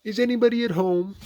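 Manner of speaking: high, loud call. A narrator's reading. Pooh calling into a rabbit hole, calling out. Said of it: "and called out".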